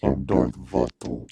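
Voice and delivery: disguised voice. Acted. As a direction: hide who you are with a deep slow voice. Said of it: "give me a different voice".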